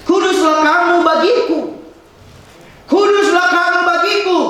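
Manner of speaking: proclaimed verse. Acted. Preacher proclaims the verse loudly.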